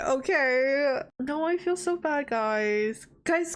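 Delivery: ordinary delivery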